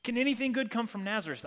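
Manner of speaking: pitched high, doubtful and mocking; skeptical scoff. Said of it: "Nathanael's the skeptic".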